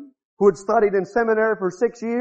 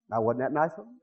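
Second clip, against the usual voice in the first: sarcastic; 8 semitones lower, 9 dB quieter than usual. Acted. Mocking the insulting Catholics.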